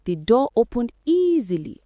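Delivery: ordinary delivery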